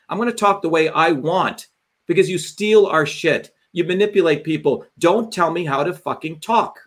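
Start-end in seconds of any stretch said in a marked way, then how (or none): none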